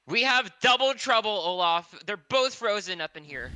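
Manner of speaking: yelling back. Acted. Player yelling back to Olaf about frozen friends, yelling. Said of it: "I yell back to Olaf saying".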